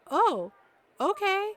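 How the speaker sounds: high, loud mock surprise; taken aback. Boyfriend reacting to her telling off rude titas, taken aback. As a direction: swoop up high as if startled.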